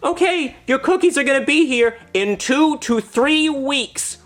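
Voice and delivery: put-on very high, slow little-girl voice; Girl Scout voice. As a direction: explain it sweetly and slowly. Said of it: "the Girl Scout says".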